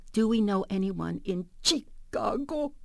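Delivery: slow question, Chicago begun with a hard "ch"; mom's drawn-out "ch" in Chicago; mispronounced CH. Retold or imitated. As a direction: say Chicago as "Chee-cago", deliberately broken up. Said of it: "pronounced the C H with a ch sound".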